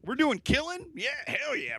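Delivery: high, fast, eager boomer impression; excited boomer. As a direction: get suddenly thrilled about the game. Playing boomer keen on killing games.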